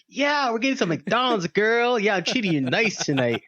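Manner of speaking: high-pitched hammed-up generous boyfriend; playful boasting. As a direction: brag playfully as if offering a grand treat. Joke, acts the boasting boyfriend.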